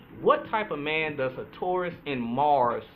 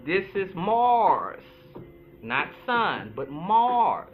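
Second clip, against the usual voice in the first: slow, heavy Mars each time; stressing Mars; much slower than usual. Real, not acted. Host insisting to viewers, heavy stress on Mars. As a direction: hammer home Mars, not sun. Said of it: "It's very important that I tell you that".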